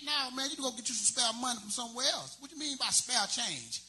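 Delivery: usual speaking voice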